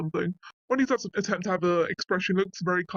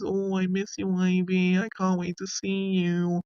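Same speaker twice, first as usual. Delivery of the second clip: put-on drawn-out, sing-songy baby talk; mock sing-song baby voice; cutesy baby voice. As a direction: coo it slowly, imitating a cutesy baby voice. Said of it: "he does a very cutesy baby voice".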